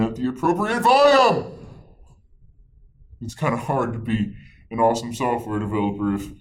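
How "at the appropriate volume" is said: suddenly loud and higher; too loud. Shown as a demonstration. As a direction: blurt it out far too loud. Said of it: "if you're too loud".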